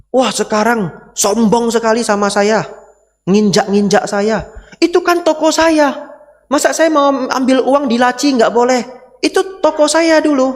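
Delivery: high, loud complaint of a wronged father; aggrieved old father. Retold or imitated. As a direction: whine it out like an old man whose son took over his shop. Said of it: "papanya curhat ke kita". (ayah tua mengeluh dengan suara tinggi dan keras)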